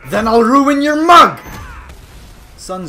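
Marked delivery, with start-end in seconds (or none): 0.1-1.4 high, loud roar at an opponent; mock threat yell. Rejected hugger threatening opponent, loud mock-angry yell.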